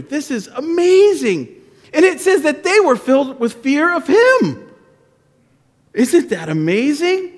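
excited, wondering storyteller. Real, not acted. Pastor marvelling with kids at the story, excited storytelling.

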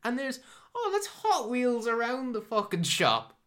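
mocking goofy voice. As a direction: lampoon the scene in a squeaky voice.

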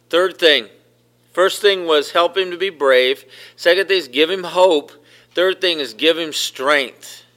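loud, driving sermon cadence; preaching at full force. Acted. Pastor rallying the congregation, preaching loud and hard. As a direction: hammer each point home to the church.

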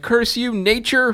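dramatic curse. Retold or imitated. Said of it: "just some, like".

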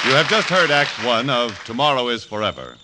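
loud, bright announcer read of the show credits; radio announcer. Acted. Announcer to the radio audience between acts, booming announcer read. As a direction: sell the programme to the listeners at home.

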